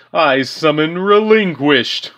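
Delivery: slow, theatrical cartoon villain voice, laughing; Pegasus impression. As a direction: ham it up like a smug anime duelist. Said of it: "Pegasus impression".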